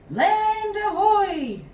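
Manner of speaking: high, loud, slow pirate call. A narrator's reading. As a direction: stretch it out like a lookout. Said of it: "called Jack".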